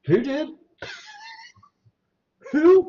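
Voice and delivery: high, puzzled questions; baffled, asking who; surprised. Real, not acted. Confused by movie reference.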